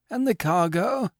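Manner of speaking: high, eager owner asking; eager question. A narrator's reading. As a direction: jump in eagerly about the cargo. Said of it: "inquired the owner eagerly".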